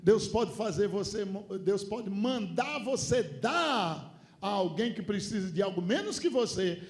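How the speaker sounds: loud, high preaching shout; preaching at full force. Acted. (pregação aos gritos)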